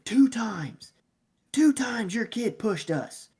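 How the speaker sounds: high, drawn-out mock scream; retold screaming. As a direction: imitate an outraged dad hollering. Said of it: "he starts screaming".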